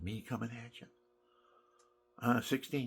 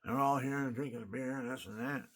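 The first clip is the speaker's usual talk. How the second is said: normal talking voice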